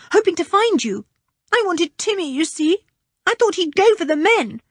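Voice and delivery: put-on young boy rushing out his frightening tale; anxious boy. Richard continues his story.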